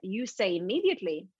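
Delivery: normal talking voice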